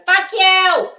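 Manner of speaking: loud, high hammed-up yell; imitated livestreamer's outburst; mock scream. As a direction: scream it in parody. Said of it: "I was screaming".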